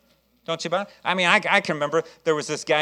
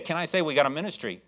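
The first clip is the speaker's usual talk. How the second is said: ordinary talk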